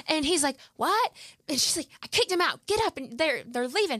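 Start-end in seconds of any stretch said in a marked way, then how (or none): 0.7-1.1 high, startled what, jolted awake; shocked what. Retold or imitated. Dad woken with shocking news. 2.0-3.0 high, insistent command to get up; urgent wake-up order. Retold or imitated. Mom urging sleeping husband.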